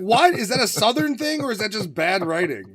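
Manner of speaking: incredulous. Real, not acted. Incredulous about the line.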